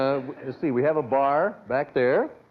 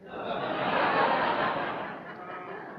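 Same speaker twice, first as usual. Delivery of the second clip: breathy laugh; 12 semitones higher, 4 dB quieter than usual. Real, not acted. Host laughs at own joke.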